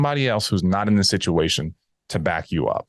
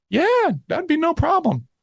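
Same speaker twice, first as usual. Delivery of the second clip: much higher and slow, the easygoing client agreeing; mock client voice; slower than usual. Dom imitating the client agreeing on speaker, high and slow. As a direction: play the client cheerfully saying yes, pitched way up.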